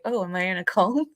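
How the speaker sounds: normal talking voice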